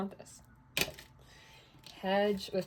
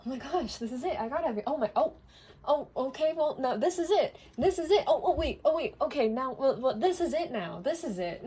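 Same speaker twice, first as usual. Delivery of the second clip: higher, rising giddy rush of oh this is it; excited realisation; 6 semitones higher, 3 dB louder than usual. Retold or imitated. Reader acting out a client's delight at more and more, excitedly. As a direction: keep finding there is still more, each time higher and more thrilled. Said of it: "where you're like".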